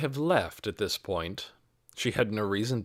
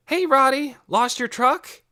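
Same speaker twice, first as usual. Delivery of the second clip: high, loud teasing call across the yard; boy calling to his little brother, much higher and louder; 7 dB louder than usual. A narrator's reading. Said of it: "he called".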